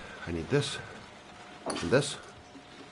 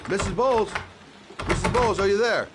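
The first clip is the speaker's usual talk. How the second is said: calling out; 12 semitones higher, 3 dB louder than usual. Acted. Searching the house, calling.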